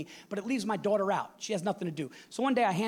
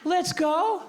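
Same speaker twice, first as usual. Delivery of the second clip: put-on defiant kid; 12 semitones higher, 5 dB louder than usual. Son repeats defiantly.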